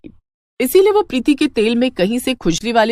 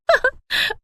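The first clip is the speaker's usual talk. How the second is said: high mischievous giggle. Acted. Scheming daughter-in-law laughs.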